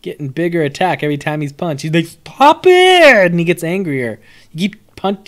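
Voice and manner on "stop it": high mock yell of an angry card monster; angry doughboy. Reviewer voicing the punched minion's high, loud yell. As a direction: shriek it like a fed-up punching bag. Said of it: "like the Pillsbury Doughboy got sick of your shit".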